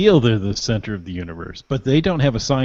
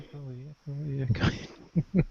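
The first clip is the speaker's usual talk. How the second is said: fading echo, each repeat quieter; echo effect; 13 dB quieter, slower than usual. Shown as a demonstration. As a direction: mimic a room's echo dying away.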